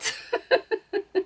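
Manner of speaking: a high amused laugh. Real, not acted. Laughing after voicing the cat. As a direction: laugh at your own cat voice.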